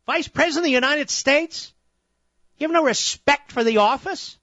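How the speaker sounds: high-pitched, outraged rhetorical questions; indignant tirade. Real, not acted. Host's indignant tirade at opponents.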